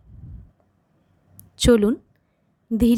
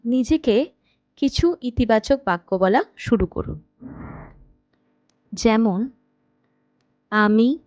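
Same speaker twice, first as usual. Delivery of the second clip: guided meditation. Acted. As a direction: guide them unhurriedly into rest, phrase by phrase. Leads a guided night meditation. (ধীর লয়ে, থেমে থেমে ধ্যান করানোর কণ্ঠ)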